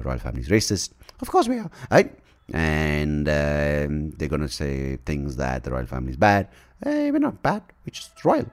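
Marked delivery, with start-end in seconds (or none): 1.2-2.2 high, posh-put-on old queen; a falsetto queen impression, lightly hammed; mock Queen voice. Queen voice answers. 6.8-8.5 high, posh-put-on old queen; a falsetto queen impression, lightly hammed; mock Queen voice. Queen voice protests.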